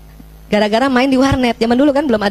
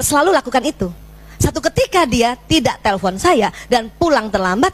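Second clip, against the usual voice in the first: usual speaking voice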